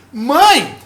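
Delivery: high, loud, impassioned appeal; preacher acting out an urgent plea; Jesus pleading with his mother. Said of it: "E Jesus tá falando assim".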